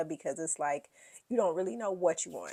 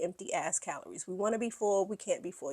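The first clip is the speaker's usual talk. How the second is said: ordinary talk, nothing marked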